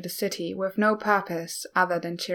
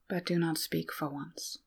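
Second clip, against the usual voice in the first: quiet; 2 semitones lower, 9 dB quieter than usual. A narrator's reading. Asking a companion not to speak, quietly. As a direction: say it softly, under the narration. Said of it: "Bei said quietly".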